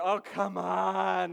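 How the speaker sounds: loud, long drawn-out groan; imitated family groan of disbelief; exasperated groan. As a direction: groan it slowly like a whole fed-up family. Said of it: "everybody just groans, the whole family is like".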